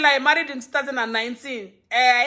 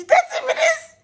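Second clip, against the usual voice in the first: much higher, laughing through it; laughing squeal. Real, not acted. Telling viewers about baby waking at night, laughing very high.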